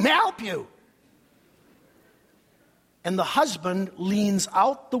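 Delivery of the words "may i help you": sudden, higher-pitched speaker-box greeting; drive-thru speaker voice. Retold or imitated. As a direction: jump out like a drive-thru intercom. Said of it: "this voice jumps out at you".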